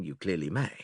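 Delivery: ordinary talk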